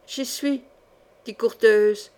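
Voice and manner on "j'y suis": small, softer answer; dazed with terror. A narrator's reading. Terrified Courteheuse answers the voice, hushed dazed fear. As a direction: answer faintly, stunned by dread. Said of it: "hébétée d'épouvante".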